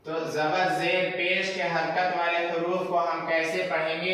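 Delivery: ordinary talk, nothing marked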